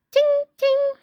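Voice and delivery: high, bright ting noise; shield ting sound. A narrator's reading. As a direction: tap the pretend shield with a ting.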